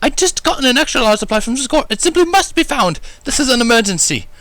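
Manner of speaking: high, refined lady's voice in a fuss; a fussy, elegant unicorn hunting for her supplies; Rarity. A narrator's reading. Rarity's voice in the story.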